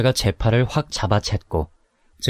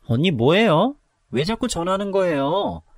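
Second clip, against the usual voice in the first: irritated, higher voice picking up the phone; annoyed; 7 semitones higher than usual. Acted. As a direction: sound fed up with the calls. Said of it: "너무 짜증난다는 목소리로". (짜증난 목소리)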